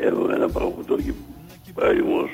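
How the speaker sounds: barely voiced, throat closed up after ice cream; real hoarse, lost voice. Real, not acted. Caller with a closed-up throat after ice cream answers the host, hoarse and half-voiced. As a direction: rasp the words out through a sore throat. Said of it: "έκλεισε ο λαιμός".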